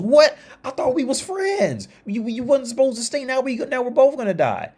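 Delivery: very high, squealing mock outrage; hammed-up high-pitched shock and dismay; betrayed frog. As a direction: cry out as a frog stabbed by a friend. Stung frog reacts to betrayal.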